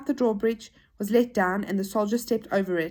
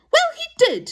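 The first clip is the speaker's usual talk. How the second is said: very high, loud retort; insistent toy; 7 dB louder than usual. Acted. Toy contradicts Joe about the theft, insistent.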